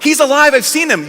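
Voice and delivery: breathless news-bearer. Retold or imitated. Mary bursts in on the apostles, excited announcement. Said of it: "she bursts in".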